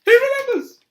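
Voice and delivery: very high, loud squeal of delight; overjoyed cry. A narrator's reading. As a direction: cry out in sheer excitement. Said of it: "cried Diddulus Diggle".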